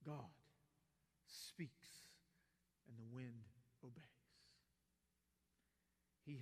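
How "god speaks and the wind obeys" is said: hushed awe. Real, not acted. Hushed awe at God's power.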